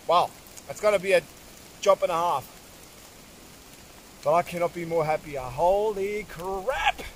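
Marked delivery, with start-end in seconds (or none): 5.4-7.0 slow, stretched in awe; awed exclamation. Real, not acted. Hunter stunned at the size of the bull, slow awed exclamation. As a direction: let it out slowly, stunned.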